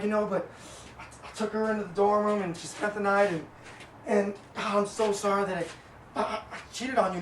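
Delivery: soft, halting mock confession; feigned remorse. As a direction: confess quietly, acting sorry. Retelling his fake tearful confession.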